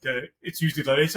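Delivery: normal talking voice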